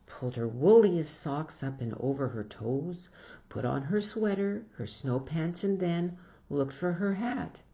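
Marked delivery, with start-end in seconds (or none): none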